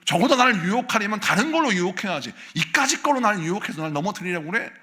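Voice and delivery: rebuking the tempter in prayer; angry prayer. Shown as a demonstration. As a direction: pray with indignant force. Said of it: "막 분노하면서 기도하면서".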